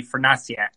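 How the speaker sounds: [name] said with "ah" in the middle, fer-NAH-shak; Polish pronunciation. Shown as a demonstration. Guest correcting his family name for host, [name] with Polish "ah". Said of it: "the Polish pronunciation should be".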